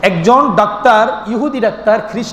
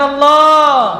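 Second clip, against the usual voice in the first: higher, sustained call of Allah; loving devotional call; 4 semitones higher, much slower than usual. Shown as a demonstration. Preacher urges congregation to call with more love, loving devotional call. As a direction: call out the name with love, held long. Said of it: "আর একটু মহব্বতে ডাকেন".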